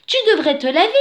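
a hint of a firm she-bear; lightly voiced she-bear. A narrator's reading. She-bear tells the dirty bear to wash, lightly voiced character.

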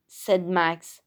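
ordinary delivery, nothing marked

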